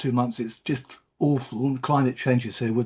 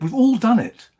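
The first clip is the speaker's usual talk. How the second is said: much higher and louder, repeated with force; emotional outburst; 9 semitones higher, 6 dB louder than usual. Real, not acted. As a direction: burst out with it the second time. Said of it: "you can see I'm quite emotional".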